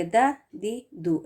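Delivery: دا دی دو short and light; correct short vowels. Shown as a demonstration. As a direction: say دا دی دو crisply. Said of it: "اس طرح سے". (درست ادائیگی، چھوٹی حرکات)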